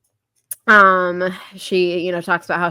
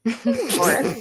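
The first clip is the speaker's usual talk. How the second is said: Embarrassed said slowly through a laugh; laughing at own slip; 5 semitones higher, slower than usual. Real, not acted. Podcaster laughing as she corrects Embarrassed. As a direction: laugh while fixing the flubbed word Embarrassed. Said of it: "embor-, uh, embarrassed".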